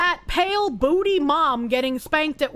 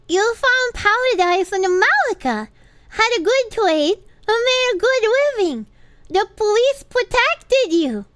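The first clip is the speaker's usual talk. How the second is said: put-on higher, cutesy baby-talk uwu voice; 5 semitones higher than usual. Streamer reading a film monologue on request, uwu voice. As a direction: read it in a high uwu lisp. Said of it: "In an uwu voice".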